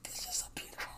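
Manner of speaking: breathless whisper; soft whispered compliment. Real, not acted. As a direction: whisper it like a secret.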